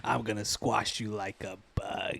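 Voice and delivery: slow, soft menacing threat; Willem Dafoe voice. Acted. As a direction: purr the threat like a villain. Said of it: "more like a Willem Dafoe".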